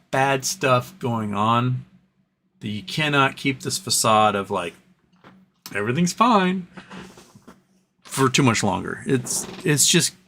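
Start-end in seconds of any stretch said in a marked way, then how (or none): 5.7-6.7 mock everything's fine. Mocking facade of normalcy.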